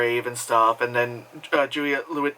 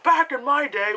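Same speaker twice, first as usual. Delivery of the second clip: lightly played old-timer, a bit higher; a hint of a grumpy old-timer; mock boomer; 11 semitones higher than usual. Vlogger teasing herself as a boomer, mock grumpy. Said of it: "I know I sound like a boomer".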